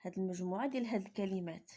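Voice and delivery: normal talking voice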